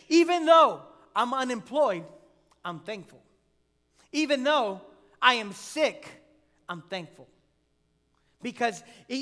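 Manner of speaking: loud preacher's build on each "Even though," dropping for "I'm thankful"; rising sermon cadence with loud peaks; preaching. Acted. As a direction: drive the point home from the pulpit. Preaching builds to full force.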